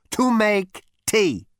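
slow, controlled patience; with restraint. A narrator's reading. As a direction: hold your temper, measured and slow. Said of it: "said Zaphod with wonderful restraint".

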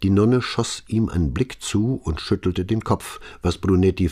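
usual speaking voice

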